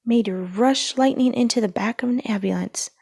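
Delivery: ordinary delivery, nothing marked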